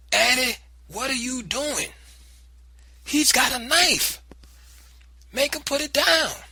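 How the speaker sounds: high, loud and panicked; alarmed cry. Acted. Grandmother seeing the fight, panicked loud cry.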